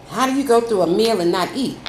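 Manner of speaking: ordinary talk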